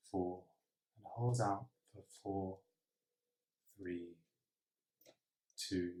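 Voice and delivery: soft breathing-exercise voice, long pauses between counts; hushed, calm meditation guide; guided meditation. Acted. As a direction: count breaths quietly and evenly.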